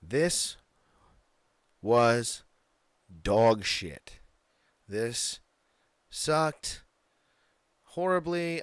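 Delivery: dog shit said loud, with long pauses between words; emphatic, word by word. Real, not acted. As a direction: drag out each word of the verdict.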